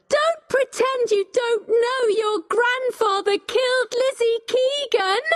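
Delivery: high, outraged outburst; crying out accusingly. A narrator's reading. Becky accusing Richard about his grandfather, crying out. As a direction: keep the voice high and accusing throughout. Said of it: "Becky cried".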